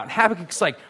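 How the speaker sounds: normal talking voice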